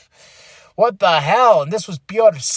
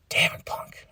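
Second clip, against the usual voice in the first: whispered curse, softer; whispered exasperation; 9 dB quieter than usual. Retold or imitated. Fan reacting to Punk's tease, whispering. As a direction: whisper it under the breath.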